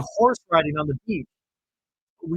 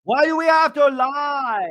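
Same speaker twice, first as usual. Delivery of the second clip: high, loud imitated outburst; host keeps mimicking Lisa, high and loud; exploding shout; 10 semitones higher, 4 dB louder than usual. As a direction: keep yelling it at the table. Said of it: "how she explodes".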